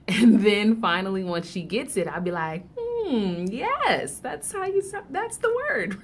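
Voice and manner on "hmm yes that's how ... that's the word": very high, cooing mock-parent praise; acted parental praise. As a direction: coo high, as if applauding a small child. Parent praising child who finally reads.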